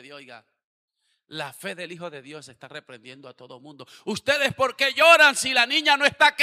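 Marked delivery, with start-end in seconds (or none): 4.0-6.4 very loud, high challenge to the crowd; rebuking shout. Acted. Jesus rebukes the weeping mourners, shouting. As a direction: demand an answer, full voice.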